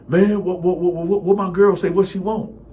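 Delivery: high, loud stammering panic; panicked friend. Retold or imitated. Friend panics after hearing his girl called, high and loud. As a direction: blurt it out in a rush of panic.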